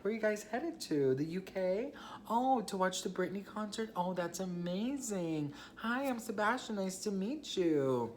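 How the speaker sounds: high, sugary forced politeness; fake cheerful chatter. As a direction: pretend you're totally fine talking to the ex. Fake cheerful small talk with ex.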